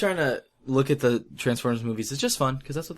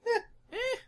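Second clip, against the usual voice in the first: meh shrug noise; 4 dB quieter than usual. Retold or imitated. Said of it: "I'd be like".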